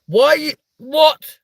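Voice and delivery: high, slow, disbelieving squeal; stretched-out gasp of shock. Real, not acted. Streamer reading a chat guess, shocked high squeal.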